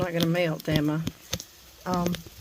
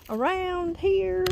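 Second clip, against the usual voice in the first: "Around here" sung out much higher and slowly stretched; drawn-out high echo; 10 semitones higher, much slower than usual. Real, not acted. Echoing herself to viewers on a walk, "Around here" high and slow.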